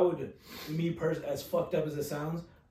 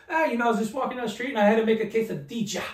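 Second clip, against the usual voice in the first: quoting a friend with overdone Spanish pronunciation, raised and quick; lightly mocking the heavy accent; mock exaggerated Spanish; 6 semitones higher, 3 dB louder, faster than usual. Friend teases how a woman pronounces quesadilla, mock accent. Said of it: "I love how you just put that pronunciation on her name".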